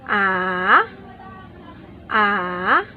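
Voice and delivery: "A" dipping low then rising, third tone. Shown as a demonstration. Teacher demonstrating third tone, dipping "A". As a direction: drop "a" down then bring it back up. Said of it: "第三声, third tone".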